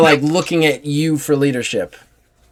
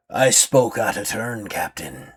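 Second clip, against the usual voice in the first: slow, softer, sly pirate yielding; crafty pirate backing down; 5 semitones lower, 8 dB quieter, slower than usual. Acted. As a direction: give way, but keep it cunning. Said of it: "Simon is a crafty pirate".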